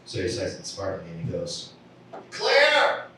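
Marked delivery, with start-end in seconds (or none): 2.3-3.1 high, loud call for a friend. Acted. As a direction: call across the theater, pitched high. Man calling third friend across theater.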